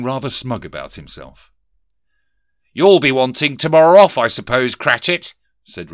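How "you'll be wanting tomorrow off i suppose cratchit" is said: higher, louder boss voice of a sneering Scrooge; stern miser boss. Acted. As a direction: bark it down at the clerk. Scrooge to clerk.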